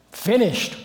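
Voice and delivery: finished called out high and loud; exultant proclamation; emphatic cry. Real, not acted. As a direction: ring out the word like a triumph. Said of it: "What's finished?".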